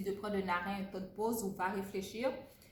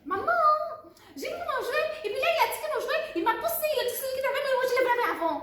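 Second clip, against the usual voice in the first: put-on much higher, louder, rushed kid's complaint; fast upset child; 9 dB louder, much faster than usual. Shown as a demonstration. Demo child rushing to mom, high and loud. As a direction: blurt out the toy fight too fast and too high. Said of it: "tu parlais vite, vite, vite".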